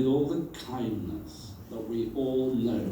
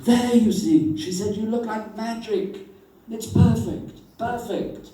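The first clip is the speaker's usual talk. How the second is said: high and loud, thrilled; high delighted encouragement; gushing praise; 10 semitones higher, 6 dB louder than usual. Retold or imitated. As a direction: cheer her on, delighted.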